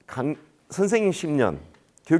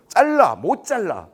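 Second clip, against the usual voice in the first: loud back-and-forth; 6 semitones higher, 5 dB louder, much slower than usual. Retold or imitated. Parent and official bickering by phone, loud back-and-forth. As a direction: yell the command, answer it stubbornly. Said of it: "큰 소리로".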